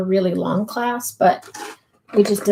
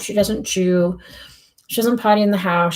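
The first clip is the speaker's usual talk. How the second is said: ordinary talk, nothing marked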